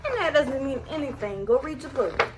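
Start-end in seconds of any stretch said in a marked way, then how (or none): none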